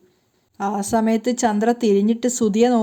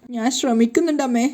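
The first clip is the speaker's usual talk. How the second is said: plain reading voice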